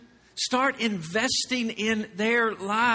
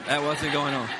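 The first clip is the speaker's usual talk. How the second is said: laughing; 5 semitones lower than usual. Real, not acted. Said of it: "I'm joking".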